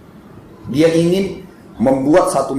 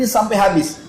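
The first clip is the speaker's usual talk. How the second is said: usual speaking voice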